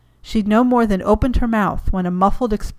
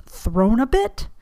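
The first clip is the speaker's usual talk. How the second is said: slow, higher rising disbelief; incredulous; 5 semitones higher, slower than usual. Acted. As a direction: mouth it in disbelief, drawn out. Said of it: "Diana mouthed an incredulous".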